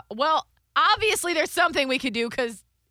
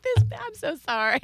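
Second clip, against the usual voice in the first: mock apologetic; 6 semitones higher than usual. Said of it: "You act like it's like".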